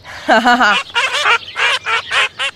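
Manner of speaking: high mocking duck laughter; ducks laughing. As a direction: cackle at the outcast. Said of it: "Laugh all the other ducks".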